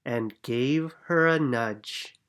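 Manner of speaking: ordinary delivery, nothing marked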